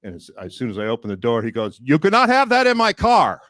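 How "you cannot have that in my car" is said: much higher, louder stern command; mock driver's stern order; strict driver. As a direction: bark the rule like a fussy driver. Driver forbids coffee.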